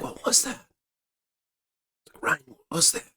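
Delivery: imitating a scared, hushed whisper; frightened whisper. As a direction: whisper it as if just woken by a noise outside. Waking Morgan frightened in tent.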